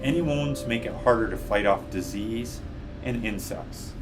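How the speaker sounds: ordinary delivery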